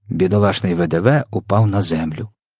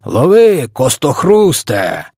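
high, loud drawn-out call; shouting; 5 semitones higher, 5 dB louder, much slower than usual. A narrator's reading. A giant tosses the victim on to the next, shouting. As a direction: holler it out with long vowels. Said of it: "і закричав".

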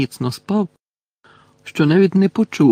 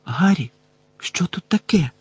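mildly anxious, higher question; frightened girl; 5 semitones higher than usual. A narrator's reading. Frightened Ginny asking Harry, understated alarm. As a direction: raise the pitch slightly, worried. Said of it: "запитала перелякана Джинні".

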